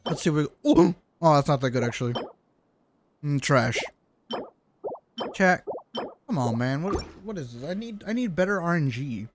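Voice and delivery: ordinary talk, nothing marked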